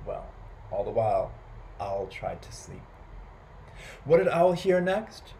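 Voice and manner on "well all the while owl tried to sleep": ordinary delivery, nothing marked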